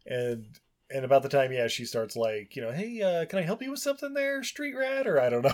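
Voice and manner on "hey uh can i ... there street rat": much higher, fast mock girl; quick high put-on taunt; mock shop girl challenging a customer; snarky shop girl. Shop girl confronts boy.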